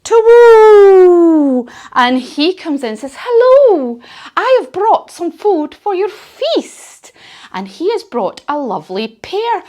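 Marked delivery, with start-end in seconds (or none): 0.0-1.7 high, loud hooting owl call; a big high owl hoot. Acted. Owl arriving in yoga story. 3.2-7.1 high, bright character voice for a visiting animal; high voiced forest friend bringing food; cheery animal guest.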